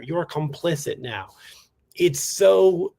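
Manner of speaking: usual speaking voice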